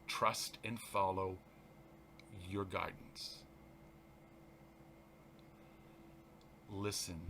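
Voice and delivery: slow, soft, prayerful reading; soft spiritual text read aloud; meditative reading. Acted. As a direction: let each line settle like a breath. Reads text softly.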